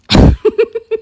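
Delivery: bright high giggle after the line. Real, not acted. Crafter giggling at her own card idea, high laugh.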